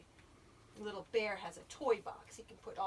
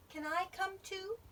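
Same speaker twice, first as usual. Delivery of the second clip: high little character voice; hopeful Little Bear; 10 semitones higher than usual. A narrator's reading.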